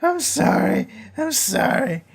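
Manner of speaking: high, whiny fake sobbing plea; mock crying apology. Mocking a killer's acted TV apology, high fake crying. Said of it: "he just starts crying".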